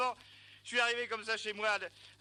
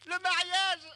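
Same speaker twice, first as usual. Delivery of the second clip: laughing; 8 semitones higher, 5 dB louder, slower than usual. Real, not acted. Realizing he missed the wedding.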